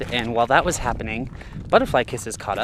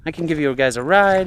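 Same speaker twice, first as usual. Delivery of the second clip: higher-pitched, enthusiastic offer; lifted, lively quoted voice; imitated friendly stranger; 7 semitones higher, 4 dB louder than usual. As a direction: act out an eager local offering help. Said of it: "and he's like".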